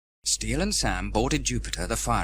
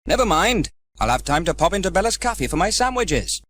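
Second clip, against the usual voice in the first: voicing Sam; 7 semitones higher, 6 dB louder than usual. Sam's cheerful quote.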